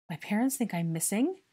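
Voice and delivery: shocked question. A narrator's reading. Boy learns his parents think he is missing, startled. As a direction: ask it in startled alarm.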